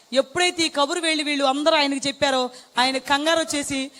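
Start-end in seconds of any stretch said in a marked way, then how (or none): none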